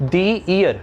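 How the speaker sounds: the said "thee" before ear; long ee the, "thee ear". Shown as a demonstration. Teacher models "thee ear" for class. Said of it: "the हमारा the".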